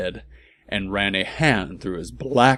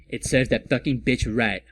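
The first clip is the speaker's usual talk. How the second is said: ordinary talk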